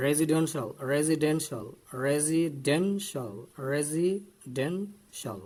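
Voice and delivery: slow, deliberate "residential"; teacher drilling "residential" in separated syllables; model pronunciation. Shown as a demonstration. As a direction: sound out "residential" syllable by syllable, stretching it. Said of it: "We should say".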